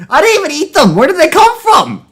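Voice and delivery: high-pitched baffled protest; incredulous. Real, not acted. Baffled outburst at the story.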